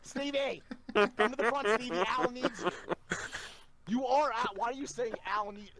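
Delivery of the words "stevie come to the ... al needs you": mock radio shouting, much higher; calling over the radio. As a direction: yell the name like a boss on a walkie. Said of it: "this guy kept over the radio".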